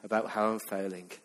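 soft, tired, sighing mock complaint; mock exhausted. Preacher imitating a tired churchgoer, soft and weary. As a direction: sound worn out by yet another sermon. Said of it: "some are exhausted".

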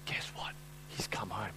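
hushed conspiratorial news; secretive whisper. Acted. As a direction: whisper it like gossip. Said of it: "servant, whispered news".

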